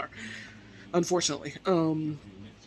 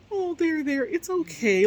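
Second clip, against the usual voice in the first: high-pitched soothing coo, slowing down; imitated sweet comforting; 10 semitones higher than usual. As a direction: console someone in a high, drawn-out voice. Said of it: "she's comforting him".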